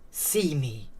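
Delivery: soft, inward plea; silent pleading. A narrator's reading. Unseen boy pleads in his head to be noticed, silently. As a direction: plead under the surface, softly. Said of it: "silently pleaded".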